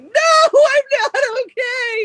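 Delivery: high cracking voice, near tears; choked-up whine. Retold or imitated. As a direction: whimper it like you're about to cry. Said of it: "He's like".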